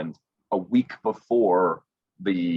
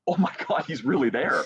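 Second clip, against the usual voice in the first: high-pitched amazed realization, ending in laughter; retold amazement; 8 semitones higher than usual. Winner realizing the host is really live, acted amazement. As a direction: act out being starstruck, pitch jumping up.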